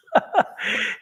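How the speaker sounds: high, voiceless laugh; amused high chuckle; real chuckle. Real, not acted. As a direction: let a short laugh escape mid-thought. Amused, searching for tactful words.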